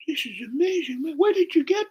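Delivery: high falsetto imitation full of wonder; mock awe, very high; amazed girl. Girl marvels at the joint.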